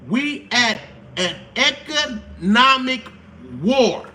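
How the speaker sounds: drawn-out economic, slowly; slow emphatic. Shown as a demonstration. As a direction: stretch economic, then drop war. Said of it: "repeats for emphasis".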